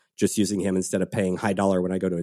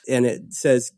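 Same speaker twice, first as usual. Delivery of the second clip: usual speaking voice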